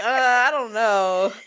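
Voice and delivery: slow, laughing unsure mumble; a laughing, hesitant "I don't know"; 1 laughing hesitant quote. Retold or imitated. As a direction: act out dithering through a laugh.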